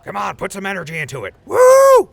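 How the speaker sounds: huge high whoop, very loud; a full-throated party woo; whooping cheer. Acted. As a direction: show him how to really whoop. Coaching Atlas to cheer.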